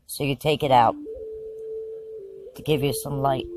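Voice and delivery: hushed, measured meditation voice; calm narration with lots of space between phrases; guided visualization. Acted. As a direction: guide them through the scene phrase by phrase, leaving long pauses.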